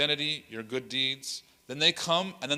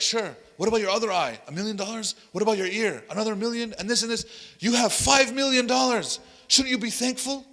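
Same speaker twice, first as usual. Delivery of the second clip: higher and louder, climbing toward a lecturing shout; pushy, building harangue; 9 semitones higher, 6 dB louder than usual. Retold or imitated. Preacher badgering a stranger about his eyes, building to a shout. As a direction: hammer each question harder until it is nearly shouted.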